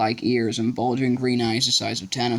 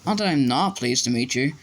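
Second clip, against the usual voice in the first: plain reading voice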